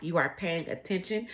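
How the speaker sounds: ordinary talk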